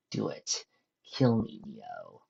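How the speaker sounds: usual speaking voice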